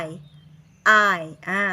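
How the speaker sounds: "I" as a drawn-out long i; isolated long vowel "I"; phonics sound. Shown as a demonstration. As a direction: say "I" like eye, held. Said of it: "สามเสียงคือ".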